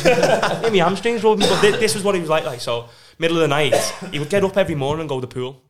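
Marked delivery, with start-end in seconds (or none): 0.1-1.5 slow, loud, laughing impression; laughing mock quote. Mocking Willow's massage excuse while laughing, slow. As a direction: drag it out through laughter.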